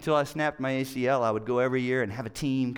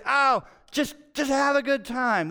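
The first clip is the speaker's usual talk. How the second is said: mock advice; 10 semitones higher, 4 dB louder than usual. Mimicking guys at church.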